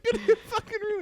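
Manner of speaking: very high cracking mock-sobbing order; crying scolding. Crying dad sending kid to his room, very high cracking voice. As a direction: try to scold but cry. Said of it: "I discipline my kid. I'm like".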